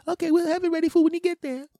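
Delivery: loud, high falsetto; squeaky, sing-out phone voice; restaurant order taker. Acted. Worker confirming the order, falsetto.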